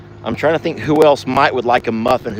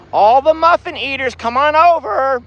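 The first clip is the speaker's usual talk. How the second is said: higher, louder call across the field; calling the herd; 12 semitones higher, 4 dB louder than usual. Real, not acted. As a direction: call out to bring the cows over.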